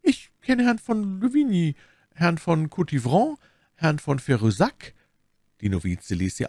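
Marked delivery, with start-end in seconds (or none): 0.0-4.9 higher, hesitant listing of names; slightly embarrassed. A narrator's reading. Milady names acquaintances to the novice, slightly embarrassed. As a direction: stall between names, a little awkwardly. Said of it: "antwortete etwas verlegen".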